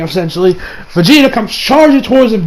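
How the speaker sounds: usual speaking voice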